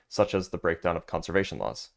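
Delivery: normal talking voice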